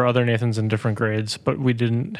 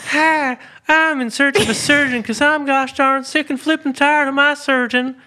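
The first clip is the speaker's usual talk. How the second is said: very high, squeaky complaining caller; fake customer voice. [name] pretends to be a customer in the fake commercial, very high and squeaky. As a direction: play a whiny ad customer. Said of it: "pretend that I was not me in the commercial".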